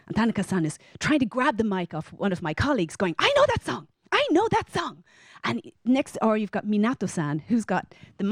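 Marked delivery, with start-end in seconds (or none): 3.2-4.9 high-pitched excited cry; eager outburst. Retold or imitated. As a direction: blurt it out, clamouring for the mic. Colleague grabbing at the karaoke mic.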